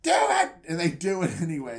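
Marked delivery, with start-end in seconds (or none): 0.0-0.5 very high, loud shriek; panicked yelled order; shrill order. Retold or imitated. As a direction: screech the command. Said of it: "he's like".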